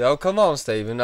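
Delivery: put-on high, loud country-accented scoff. Customer won't buy the clerk's denial, country accent. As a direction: coax him loudly in a country accent. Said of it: "He's got a real country accent".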